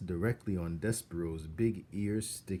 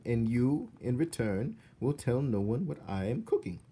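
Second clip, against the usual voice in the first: cook making a deal; 5 semitones higher, 4 dB louder than usual. Acted. Cook bargains with mouse.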